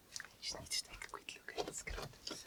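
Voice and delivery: very quiet, voiceless whisper; barely audible whisper; whispered aside. Real, not acted. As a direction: whisper it off to the side.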